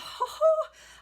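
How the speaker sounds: shrill burst of delighted laughter; high squealing laugh. Real, not acted. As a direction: let out a high gleeful squeal. Burst of glee.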